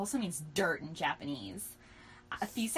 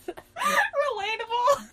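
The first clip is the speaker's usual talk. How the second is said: laughing; 7 dB louder, much slower than usual. Real, not acted. Laughing comment on own writing.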